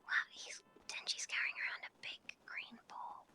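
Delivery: whisper. Pretend. Still spying, whispering.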